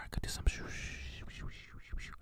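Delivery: soft whispered shushing sounds; ASMR shushing. Shown as a demonstration. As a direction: shush gently into the mic. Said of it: "I can do some A S M R".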